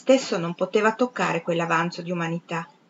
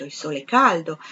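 usual speaking voice

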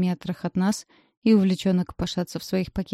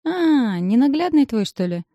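drawn-out knowing tease, pitched up; knowing drawl; 6 semitones higher, 4 dB louder than usual. Acted. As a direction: stretch it out knowingly. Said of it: "понимающе тянет".

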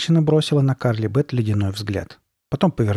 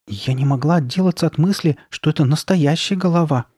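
higher-pitched, unsettled confession; shuddering unease; 6 semitones higher, 3 dB louder than usual. A narrator's reading. Sabrina unnerved by the head, mildly frightened. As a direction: let a touch of fright lift the voice. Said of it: "не в силах унять дрожь".

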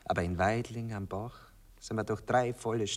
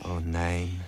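ordinary talk, nothing marked

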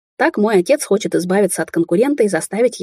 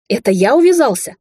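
angry yell; 5 semitones higher, 4 dB louder than usual. A narrator's reading. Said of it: "злобно заорал он".

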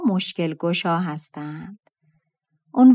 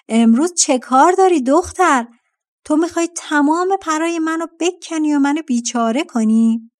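higher, louder furious vulture; angry shout; 7 semitones higher, 4 dB louder than usual. A narrator's reading. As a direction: yell at the girl stealing feathers. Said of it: "با عصبانیت ... فریاد کشید". (فریاد خشمگین کرکس)

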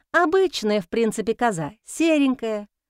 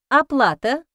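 businesslike; 3 semitones higher, 3 dB louder than usual. A narrator's reading. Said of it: "деловито осведомилась Алевтина".

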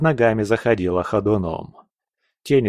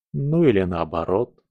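plain reading voice

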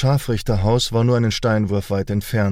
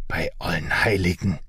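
lower, hushed and frightened; frightened gasp; 4 semitones lower, 4 dB quieter than usual. A narrator's reading. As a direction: blurt it out softly in fear. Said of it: "entfuhr es Meister Hans".

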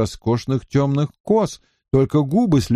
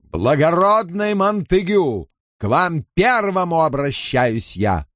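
higher, pointed public challenge; challenging nobleman; 6 semitones higher than usual. Acted. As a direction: call him out before all. Robert challenges Montagu. (вызывающее обращение рыцаря)

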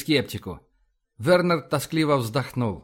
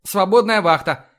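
loud, higher command call; shouted order; 5 semitones higher, 6 dB louder than usual. A narrator's reading. Iva summoning the off-duty watch, shouted order. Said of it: "крикнула Ива".

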